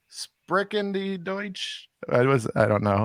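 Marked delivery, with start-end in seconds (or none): 0.1-1.9 high, soft, slow German; German accent attempt. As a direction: try a halting German accent, quietly. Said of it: "You're allowed to do a German accent".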